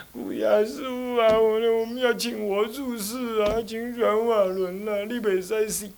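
hammed-up sob-voiced begging; mock crying disciple. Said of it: "徒弟在旁边哭". (夸张模仿徒弟哭腔哀求)

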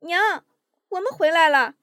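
high calling shout to mother. A narrator's reading. Han Jing calling for mother, high calling shout. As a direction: call out for mom across the yard. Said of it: "接着喊".

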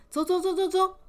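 high, loud chant of 走 urging them off; eager hurry. Acted. Queen urging the girl to come along, loud and eager. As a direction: hustle them out the door. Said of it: "这皇后一听".

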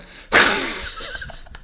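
high squealing laugh. Real, not acted. Laughing at the eagle story.

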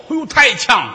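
a mock cough-cry from acrid fumes; choking on smoke. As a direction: gasp as if the smoke stings. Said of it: "偶尔来这么一下受不了". (被烟呛到的惊呼)

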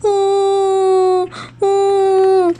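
high loud sobs; little dinosaur sobbing toward mother. A narrator's reading. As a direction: sob out loud. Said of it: "听到了哭声".

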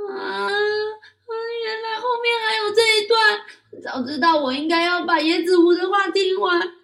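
put-on very high, loud child wail; desperate child. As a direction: refuse the marbles in despair. Child despairs, rejects marbles. (高声童音，绝望地哭喊)

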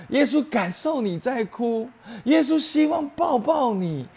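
high, loud impassioned preaching cry; 激昂高呼. Real, not acted. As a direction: lift it into a pleading, passionate climax. Preacher rising to passionate climax. (高声激昂地讲道)

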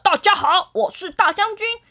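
fast, high mock general announcing herself; hammed-up general greeting, with a laugh. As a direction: play a tiny pompous general. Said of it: "我也可以学大将军的声音".

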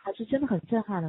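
usual speaking voice

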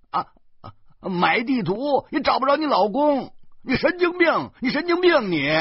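high, loud, stuttering tirade; flustered anger. Acted. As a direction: sputter repeated false starts, then yell back indignantly. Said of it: "小贩急了". (急得结结巴巴地高声回骂)

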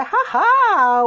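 triumphant high, loud "haha"; gleeful aha. Retold or imitated. Speaker reveals the secret snack stash to listeners, gleeful aha. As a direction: crow it like you caught someone out.

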